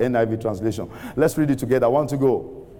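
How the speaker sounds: normal talking voice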